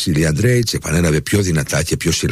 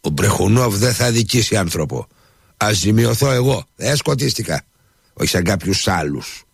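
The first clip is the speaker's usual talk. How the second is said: higher, persuasive salesman; persuasive dealer; 6 semitones higher than usual. A narrator's reading. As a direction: win the buyer over, higher. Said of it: "ξεφώνησε με εκείνο το ύφος που κατάφερνε να πείθει". (πειστικό ύφος εμπόρου, ψηλότερα)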